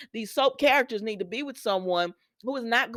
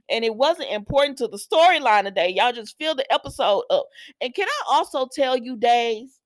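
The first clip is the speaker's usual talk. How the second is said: ordinary talk, nothing marked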